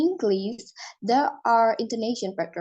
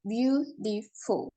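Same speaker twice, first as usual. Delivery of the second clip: slow syllable demo; 4 dB quieter, much slower than usual. Shown as a demonstration. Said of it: "three syllable".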